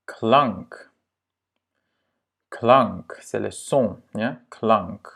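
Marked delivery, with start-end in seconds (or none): 0.1-3.1 Klank with a short A. Shown as a demonstration. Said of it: "c'est un A court". 4.5-5.1 Klank with a short A. Shown as a demonstration. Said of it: "c'est un A court".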